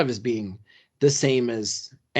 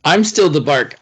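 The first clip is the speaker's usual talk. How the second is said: louder echo of the catchphrase; 3 semitones higher, 10 dB louder than usual. Real, not acted. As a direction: punch "I'm" hard and loud. Co-host echoes catchphrase louder.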